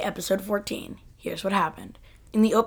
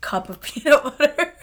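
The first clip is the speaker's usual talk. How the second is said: laughing; 7 semitones higher, 5 dB louder than usual. Real, not acted. Laughing at her full mouth.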